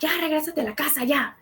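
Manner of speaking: high, rapid imitated rant; fast high-pitched mock yell; mock screaming. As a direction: mimic a screamed tirade without real volume. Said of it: "just starts screaming".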